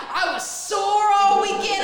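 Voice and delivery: loud, high, drawn-out brag; hammed-up triumphant client; proud boast, imitated. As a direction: crow it slowly and proudly. Client's later boast, acted out.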